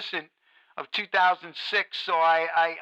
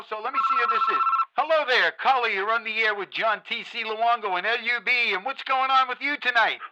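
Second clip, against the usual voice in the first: upbeat late-night radio host greeting a caller, a bit louder; bright on-air welcome to the caller; 3 semitones higher, 4 dB louder than usual. Acted. Radio host greeting a new caller on air, upbeat on-air voice.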